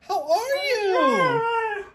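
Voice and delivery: high, very slow singsong; high drawn-out coo; dog greeting voice. Real, not acted. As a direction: stretch it out sweetly for the dog.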